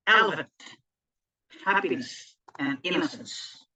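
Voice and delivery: first syllables of "elephant", "happiness", "innocence" stressed; strong-weak-weak on "elephant", "happiness", "innocence", with pauses between; dactyl stress. Shown as a demonstration. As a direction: hit EL-ephant, HAP-piness, IN-nocence on the first beat. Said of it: "the first is stressed".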